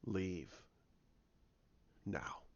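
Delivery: low, hushed order; grave command. Retold or imitated. Luke telling Rey to leave, low hushed command. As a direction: imitate an old master quietly ordering.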